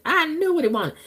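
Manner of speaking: "I knew what he wanted" jumped up high and sung out; knowing high repeat. Real, not acted. Telling y'all about Charles, the knowing high repeat.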